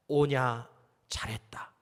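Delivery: low, soft motherly approval; mother's gentle praise. Retold or imitated. Mother praising her son for a small success, low and soft. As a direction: say it quietly, like a mother patting her child. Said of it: "제 어머니는 이렇게 말씀하셨어요".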